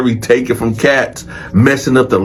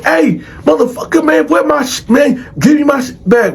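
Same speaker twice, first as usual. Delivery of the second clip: retold indignant holler; mock screaming; 12 semitones higher than usual. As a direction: imitate an inmate yelling to get his stuff back. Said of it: "other cats screaming at him".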